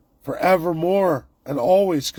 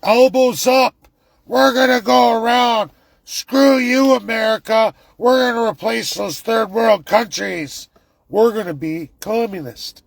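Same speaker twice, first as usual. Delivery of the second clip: higher, louder mock bravado; mock defiant Canadian; 7 semitones higher, 5 dB louder than usual. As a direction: ham up a defiant rally cry. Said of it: "anyone who's saying".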